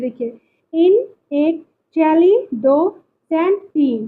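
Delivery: "इन", "चैली", "सेंट" spoken as separate beats, each followed by its number; teacher chopping the word into "इन", "चैली", "सेंट"; syllable count. Shown as a demonstration. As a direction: count out "इन", "चैली", "सेंट" one part at a time. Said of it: "इसमें कितने syllable हैं वो देखिए".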